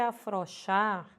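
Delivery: "afrouxar" como afroxar, O sem ditongo; pronúncia popular errada. Shown as a demonstration. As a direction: omita o U de "afrouxar". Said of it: "as pessoas costumam dizer". ("afrouxar" reduzido a afroxar, destacado)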